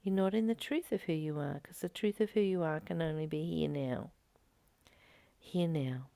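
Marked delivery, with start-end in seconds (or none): none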